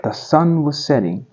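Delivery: ordinary delivery, nothing marked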